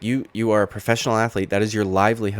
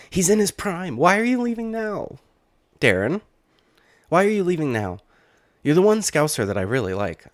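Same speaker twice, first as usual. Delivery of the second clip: hammed-up fan lament; dismayed pleading; 9 semitones higher, 3 dB louder than usual. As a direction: plead with the fighter in exaggerated, high dismay. Pleading with absent fighter to stay.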